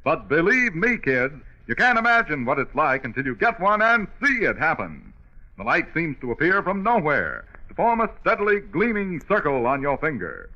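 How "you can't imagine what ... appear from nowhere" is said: normal talking voice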